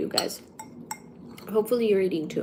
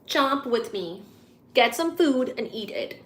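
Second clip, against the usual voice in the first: ordinary delivery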